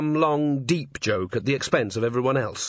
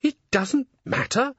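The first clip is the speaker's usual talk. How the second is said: higher, slowed, disbelieving repeat; stunned echo; 13 semitones higher than usual. A narrator's reading. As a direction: echo his words as if winded. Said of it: "a leg had been kicked away".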